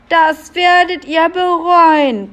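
slow, loud, high threat; croaked threat. A narrator's reading. Leo threatens escapers from the wall, croaking. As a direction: push the threat out loudly and high. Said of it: "krächzte Leo".

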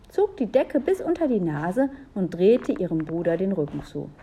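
ordinary delivery, nothing marked

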